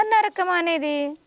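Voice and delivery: normal talking voice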